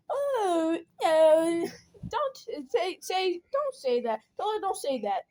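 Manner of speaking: high, drawn-out, fretting aunt voice; mock dismayed aunt. Imitates aunt reacting in dismay.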